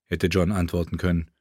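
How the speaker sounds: ordinary delivery, nothing marked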